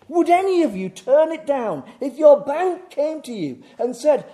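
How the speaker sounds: high, loud, rousing pulpit delivery; full-force preaching. Acted. Preacher imagining the bank's offer to congregation, full-force preaching. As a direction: preach it loud to the whole room.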